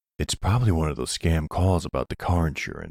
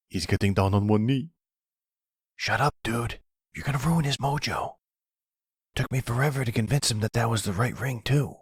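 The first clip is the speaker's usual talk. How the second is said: higher, giddy hushed chatter; excited; 6 semitones higher than usual. Acted. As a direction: gush with excitement behind his back. Excited sons whisper at proposal.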